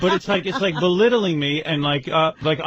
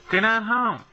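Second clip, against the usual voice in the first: mock mother; 6 semitones higher, slower than usual. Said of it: "she's like".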